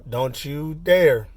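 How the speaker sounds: sharp high exclamation then slow warning; warning bark. Retold or imitated. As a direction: stop him with a slow threat. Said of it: "He says".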